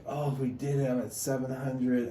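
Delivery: playful reenacted elation; retold triumph. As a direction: celebrate the milestone, voice lifted. Reliving reaching seven hundred likes.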